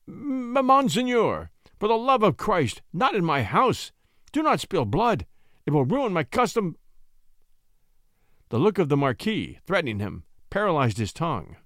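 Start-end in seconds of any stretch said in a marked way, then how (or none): 0.1-6.8 high-pitched frightened pleading; stammer, then beg in panic; terrified stammer. A narrator's reading. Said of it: "in an ague of terror, clutched the air and stammered".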